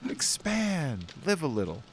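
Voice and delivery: urging mom. Retold or imitated. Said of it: "It's like".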